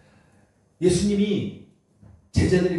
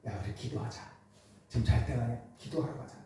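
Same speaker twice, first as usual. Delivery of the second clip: hushed urging; 8 semitones lower, 6 dB quieter than usual. Retold or imitated. Jesus urges disciples quietly.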